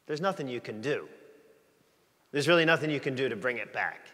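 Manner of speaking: usual speaking voice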